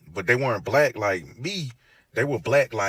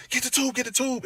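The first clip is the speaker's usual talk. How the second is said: high, urgent "get the tube"; urgent order; 13 semitones higher than usual. Retold or imitated. Nurse calling to staff over him, high urgent order. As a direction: snap the order out in alarm. Nurse reacts to the dying patient.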